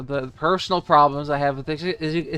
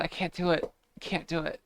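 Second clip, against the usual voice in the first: ordinary talk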